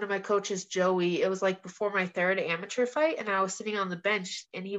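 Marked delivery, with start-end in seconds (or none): none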